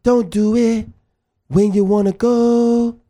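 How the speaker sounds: sing-song movie mimicry; slow, drawn-out movie-character impression; dude on The Proposal. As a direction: stretch the last words out like a chant. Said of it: "sounds like the dude on The Proposal".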